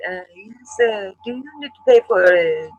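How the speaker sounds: mock Russian accent asking about payment. Russian receptionist demanding payment, mocked in a Russian accent. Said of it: "I'm now talking Russian".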